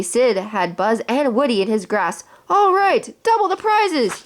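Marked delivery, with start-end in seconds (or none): 2.5-4.2 put-on much higher, excited yell of a kid winning; gleeful yell. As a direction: crow it out like a greedy bully. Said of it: "he yelled".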